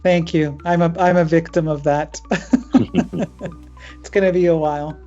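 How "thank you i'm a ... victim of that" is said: usual speaking voice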